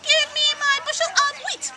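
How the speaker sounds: high, loud squeaky chick demand; shrill-voiced chick insisting; peeping demand. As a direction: pipe it like an angry little chick.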